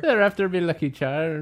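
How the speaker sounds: hint of a hammed-up Irish leprechaun; Lucky Charms leprechaun. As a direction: sing out the cereal ad line in Irish brogue. Quoting the cereal leprechaun.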